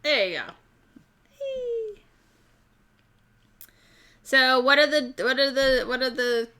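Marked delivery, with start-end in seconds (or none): 1.4-2.0 high, soft little squeal; excited squeal. Real, not acted. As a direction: let out a tiny high squeal of delight. Reacting excitedly.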